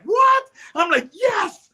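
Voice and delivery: high, loud startled shout; shocked exclamation. Retold or imitated. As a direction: blurt it out in disbelief. Retold shocked reply to police.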